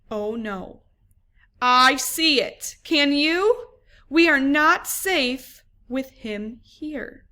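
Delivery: put-on lively storybook read for young pupils; reading to children. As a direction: read it like story time in class. Teacher reads picture book aloud.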